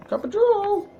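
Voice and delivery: very high squeaky Cup of joe; silly squeak. Shown as a demonstration. Repeats the phrase in a silly voice.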